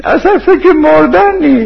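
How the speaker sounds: very high, drawn-out mock shout; high imitated holler; retold shout. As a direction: imitate someone hollering at a graveside, pitched right up.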